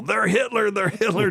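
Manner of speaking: high pointing yell of they're Hitler; mock screaming accusation. Players yelling during a board game. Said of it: "screaming and pointing at people".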